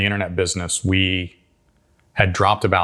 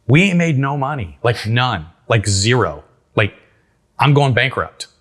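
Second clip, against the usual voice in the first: higher, louder panicked pleading; retelling a panicked outburst at his partner, higher and louder; panicked protest; 5 semitones higher, 4 dB louder, slower than usual. Retold or imitated. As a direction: blurt it out like you're going broke.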